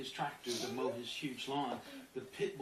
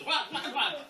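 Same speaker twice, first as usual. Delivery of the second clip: loud, higher "wah wah" babble; Sims-like gibberish; 9 semitones higher, 9 dB louder, slower than usual. Shown as a demonstration. Speaker mimicking block chatter like a Sim, loud gibberish. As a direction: nonsense sounds instead of words. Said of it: "I feel like one of the Sims".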